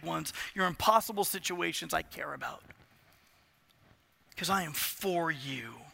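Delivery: soft, low voice of God comforting; quietly spoken divine promise; tender reassurance. Acted.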